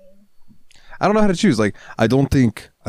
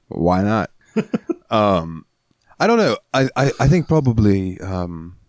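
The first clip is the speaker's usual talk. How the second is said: usual speaking voice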